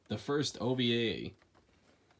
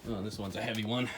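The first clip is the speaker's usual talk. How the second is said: usual speaking voice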